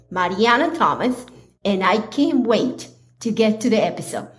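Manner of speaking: ordinary talk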